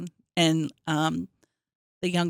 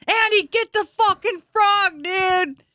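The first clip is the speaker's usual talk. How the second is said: high, loud reenacted yell at the cat; retold scream; 11 semitones higher, 5 dB louder, slower than usual. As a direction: shout at the cat again as in the moment. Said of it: "And I start screaming".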